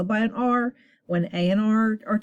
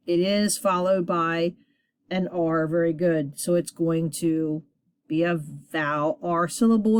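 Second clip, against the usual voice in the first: ordinary talk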